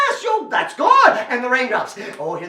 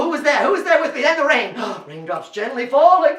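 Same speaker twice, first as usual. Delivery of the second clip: plain reading voice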